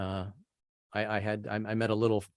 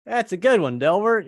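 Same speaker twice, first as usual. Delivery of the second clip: polite laugh-along; 9 semitones higher, 5 dB louder, much slower than usual. Retold or imitated. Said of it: "so I just laughed".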